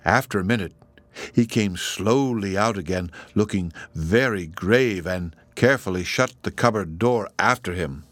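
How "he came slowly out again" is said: usual speaking voice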